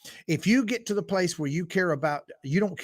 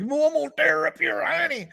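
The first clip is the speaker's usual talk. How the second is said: much higher, louder hammed-up scolding threat; silly threat voice; 11 semitones higher, 4 dB louder than usual. Shown as a demonstration. Mocking how his own quote sounded, high hammed-up threat. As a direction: ham it up like a cartoon scold. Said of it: "that sounded like".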